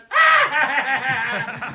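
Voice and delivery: loud cackling burst; mocking duck laugh. Duck teasing Redbird before everybody, loud cackle. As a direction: let out a big teasing cackle.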